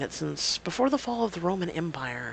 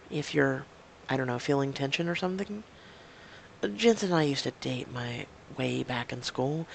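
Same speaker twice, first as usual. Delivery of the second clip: ordinary talk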